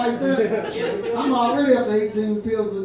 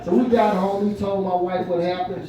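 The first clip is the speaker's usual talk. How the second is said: usual speaking voice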